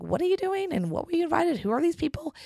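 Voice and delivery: mock-incredulous boyfriend, high and doubtful; imitating Don. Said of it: "he's like".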